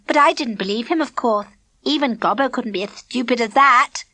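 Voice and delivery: high, prissy and smug; smug Elaine. A narrator's reading. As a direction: lord it over them with a smirk.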